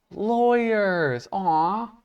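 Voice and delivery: high, drawn-out mock coo; mock adoring. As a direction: coo it as if over something cute. Mock fondness for medal recipients.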